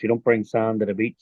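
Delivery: normal talking voice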